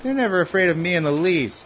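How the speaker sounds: ordinary talk, nothing marked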